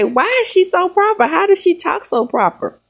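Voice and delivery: imitating nosy questioners. People asking a mother about her daughter, imitated questioning.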